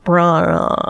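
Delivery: normal talking voice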